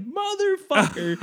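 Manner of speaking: high, drawn-out comic curse; mock exasperation. Man reaching for the Coke cup he moved, comically exasperated. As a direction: stretch it out high through a laugh. Retold frustration at out-of-reach drink.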